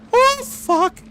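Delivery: high, shrill shriek of fear; terrified yelp. Real, not acted. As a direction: yelp in sheer terror. Monster right there.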